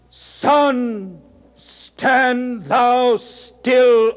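a god ordering the sun to stand still; divine command. Acted. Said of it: "Apollo called".

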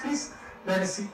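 ordinary talk, nothing marked

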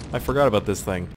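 ordinary talk

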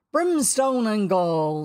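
loud, slow, thundering retort; mimicking Hook. A narrator's reading. Boy retorts as the captain, mimicking. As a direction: throw the captain's curse back at him. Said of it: "the voice retorted".

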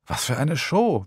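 ordinary delivery

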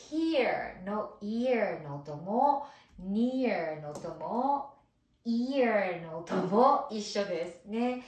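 slow explanation. Shown as a demonstration. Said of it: "slow explanation".

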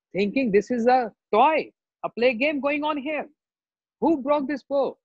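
acted wrathful threats and demands; angry mood. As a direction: scold as an outraged warrior sage. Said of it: "So much angry mood".

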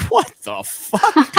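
high, drawn-out incredulous cry; mock disbelief. Crowd sees a skinny guy walk out, imitated bafflement. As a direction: stretch it out like a stunned crowd.

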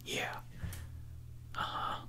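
normal talking voice

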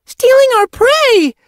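very high, loud, stretched cry; worked up, bouncing. A narrator's reading. Young apprentice adds to the alarm, worked up. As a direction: pile on indignantly, bouncing with excitement. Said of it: "bouncing on her paws".

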